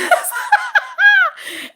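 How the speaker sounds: helpless high cackle; high laugh. Real, not acted. Cracking up at a viewer's outrageous comment, high laugh. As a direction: burst of high-pitched laughter.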